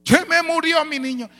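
high, lightly voiced crying mother; mother wailing over the child; mother's cry. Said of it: "la hermana gritando".

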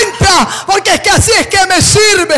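loud shouted command; God calling out. Acted. God urging Isaiah to enter, shouted call. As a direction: call him in with urgency. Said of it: "Dios le decía".

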